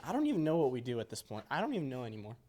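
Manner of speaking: ordinary delivery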